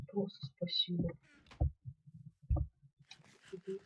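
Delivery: ordinary delivery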